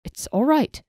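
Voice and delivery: hurried reassurance. Acted. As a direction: rush to calm her down. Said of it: "Knistrith hurriedly assured her".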